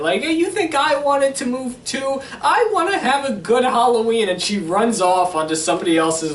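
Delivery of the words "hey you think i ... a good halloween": put-on higher, whiny upset girl; mock whiny teen. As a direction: pout it out like a hurt teenager. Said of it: "is starting to get all upset".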